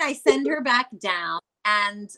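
normal talking voice

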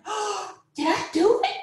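high, gasping retold amazement; gasp, then ask in high wonder; disbelief. Mother with newborn on chest, acted high disbelief. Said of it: "I just couldn't believe it".

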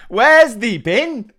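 slow, broad Yorkshire vowels; Yorkshire accent. Retold or imitated. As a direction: drawl it like a Yorkshire teacher. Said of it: "Happy Valley style".

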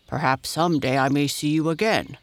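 put-on bear voice, projected; calling bear. A narrator's reading. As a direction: call out as a departing storybook bear. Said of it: "called Dido".

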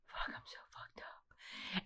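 slow whispered groan. Retold or imitated. Whispering to herself on the porch, feeling wrecked.

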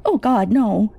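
a mother realising the worst; anguished mother. Acted. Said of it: "my mother's anguished cries".

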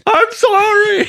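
a jokey, high-voiced sorry; high-pitched mock apology. Cutting into the friends' jokes about stabbing boars, mock apology. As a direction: squeal the apology, very high and playful.

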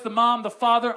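usual speaking voice

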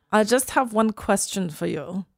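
put-on accent of a TV con woman; Anna Delvey. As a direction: imitate the character's accent, deadpan question. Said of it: "can't stop doing her accent".

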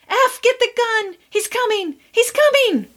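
put-on high, panicked child voice; children shrieking a warning at their brother; high terrified pleading; frightened children screaming. A narrator's reading. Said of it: "all screaming at once".

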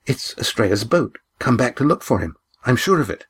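plain reading voice

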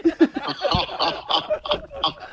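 big burst of laughter; laughing hard. Real, not acted. Laughing at the prank victim.

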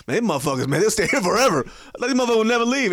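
loud imitated complaint, climbing in pitch; hammed-up, insistent grumbling. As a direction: mimic his supposed real voice, exasperated.

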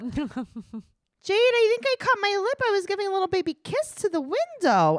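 very high, silly wheedling voice; mock pleading boyfriend. Him explaining the broken window to Jade, high mock pleading. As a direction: plead your excuse way up high. Acting his excuse to Jade.

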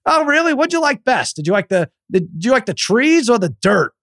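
high, prying mock voice; nosy drunk friend. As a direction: needle the kid with fake interest. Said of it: "He's like".

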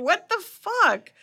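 high, slow, stunned outburst; shocked mother. Retold or imitated. Dorothy reacting to Alan's outburst, high stunned disbelief. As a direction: drag it out in disbelief. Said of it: "And Dorothy's like".

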